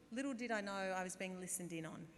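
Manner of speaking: usual speaking voice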